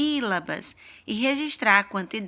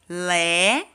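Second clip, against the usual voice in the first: LÉ on its own, vowel held; single counted syllable; 3 semitones lower, 3 dB louder than usual. Shown as a demonstration. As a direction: sound out LÉ as one separate syllable. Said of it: "quando eu pronuncio a palavra".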